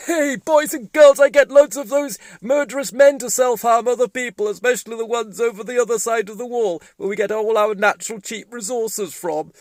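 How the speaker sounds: high, loud brash puppet boasting; a pompous puppet showing off to kids; high loud cartoon tycoon; Donald puppet voice.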